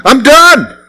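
high, forceful giving up; exasperated, final give-up; fed-up declaration. Retold or imitated. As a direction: throw up your hands and say it. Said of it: "one day you say".

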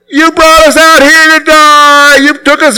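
high, whining mock complaint; high nasal-free mocking whine of the people; whiny complaining Israelites. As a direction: mimic the grumbling crowd.

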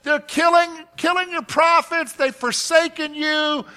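high, loud pleading lament; despairing Elijah. Retold or imitated. Elijah complaining to the Lord, loud and pleading. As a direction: cry out that you're the last one left. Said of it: "That's what Elijah says".

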